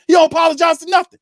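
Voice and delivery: high, loud, fast shout; angry shout. Real, not acted. Fan shouting in fury about the coach who won't apologize. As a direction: blow up at him.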